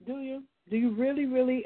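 normal talking voice

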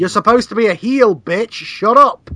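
high, loud mock scolding; mock angry yell. Real, not acted. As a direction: scold her loudly and high. Yelling at heel.

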